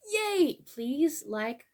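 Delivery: ordinary delivery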